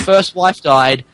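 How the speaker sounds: normal talking voice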